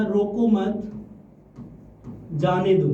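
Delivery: "रोको मत", a long pause, then "जाने दो"; pause after मत. Shown as a demonstration. Teacher showing students where the comma falls, pause after मत. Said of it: "और comma लगा दिया यहाँ".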